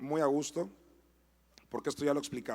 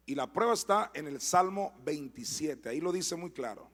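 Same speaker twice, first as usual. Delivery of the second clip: usual speaking voice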